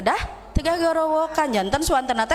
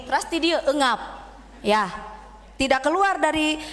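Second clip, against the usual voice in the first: ordinary delivery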